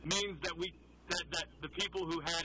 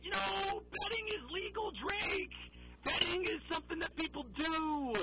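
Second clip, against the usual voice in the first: much higher, whiny mock protest; mocking apologist; 11 semitones higher than usual. Host parodies defenders of a betting player, mocking. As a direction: protest in an exaggerated high voice.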